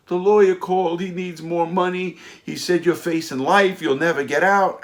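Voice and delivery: high, loud mock-hysterical wife voice; imitated hysterical crying. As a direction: act out a frantic wife wailing bad news. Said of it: "she's hysterical crying, and I mean hysterical".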